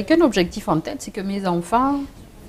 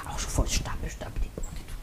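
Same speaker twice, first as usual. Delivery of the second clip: whispered aside; 11 dB quieter, faster than usual. Real, not acted. Dropping to a whisper mid-story.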